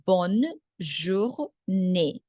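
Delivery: Bonne journée very slowly, in separate pieces; bonne, pause, jour, pause, née; syllable by syllable. Shown as a demonstration. Teacher breaks phrase into syllables.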